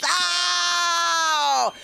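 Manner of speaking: sustained high comic "oh"; long high cry. Acted. Comic after the fish market joke, long high cry. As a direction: hold one high, wailing note.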